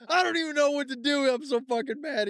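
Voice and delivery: very high, exasperated mock yell; frantic and furious, pitch shot way up; imitating frantic yelling. Friend yelling for his wife, frantic high imitation. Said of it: "yelling for his wife".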